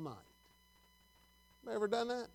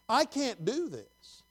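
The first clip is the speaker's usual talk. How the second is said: slow, high plea; crying out to God; 5 semitones higher, much slower than usual. Acted. Preacher voicing one who cries unto the Lord, slow high plea. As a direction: draw out a helpless plea. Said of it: "when you cry unto the Lord".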